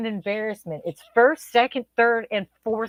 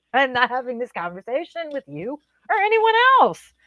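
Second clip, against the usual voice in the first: mock walkout; 6 semitones higher than usual. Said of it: "And I was like".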